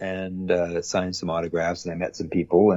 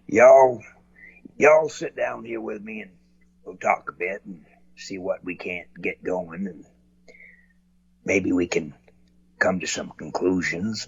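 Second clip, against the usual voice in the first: put-on Texas good old boy. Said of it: "he was a good old boy from Texas".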